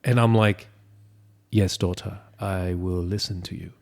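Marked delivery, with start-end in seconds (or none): 1.5-3.7 soft, subdued compliance; mock obedience. Dad answers his bossy daughter, quietly obedient. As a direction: answer meekly, like taking orders.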